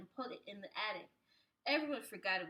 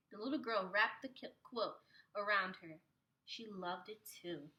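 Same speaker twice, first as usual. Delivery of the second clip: usual speaking voice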